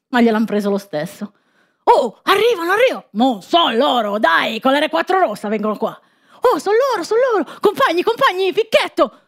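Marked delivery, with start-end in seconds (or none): 1.8-9.2 high excited calling out; excited shouting. Acted. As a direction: raise the alarm, voice climbing high. Villagers spot the trucks.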